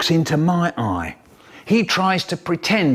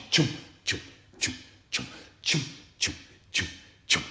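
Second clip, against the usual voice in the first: unvoiced, soft chuffing; soft repeated mouth percussion; rhythmic marching noise; 11 dB quieter, faster than usual. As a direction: whisper the beat of footsteps. Marching sounds in bear hunt.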